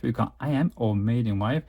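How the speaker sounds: ordinary talk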